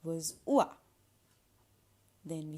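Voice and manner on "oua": "oua" as a short wah; wa sound. Shown as a demonstration. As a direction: model "oua" louder, oo gliding into ah. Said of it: "O I or O I E".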